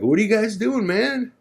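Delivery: high, friendly rocker voice; friendly rock star. Retold or imitated. [name] asking the band what they're up to, high voice.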